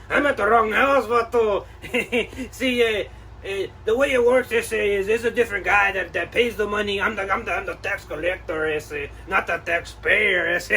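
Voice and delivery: put-on laid-back Chicano street accent, ese and vato. Collector explains, caught out.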